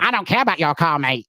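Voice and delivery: high-pitched mock girl voice; girls at retail park. Girls smoking by the boys' cars, mock high voice. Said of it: "the girls are there smoking".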